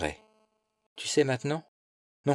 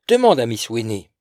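ordinary delivery, nothing marked

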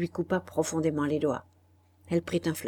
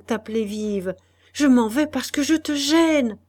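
a woman pleading in a high, loud, sorrowful voice; Madeleine pleading; 6 semitones higher, 6 dB louder than usual. Acted. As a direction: lift the voice and plead with her husband. Madeleine's lines in the novel. (femme qui supplie son mari, voix haute et forte)

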